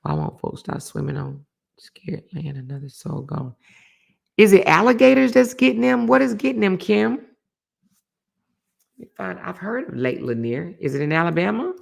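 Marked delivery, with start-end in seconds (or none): 4.4-7.1 high, loud alarmed asking; alarmed loud question. Real, not acted. Host alarmed by a drowning report, calling to a viewer. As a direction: call out to chat in alarm.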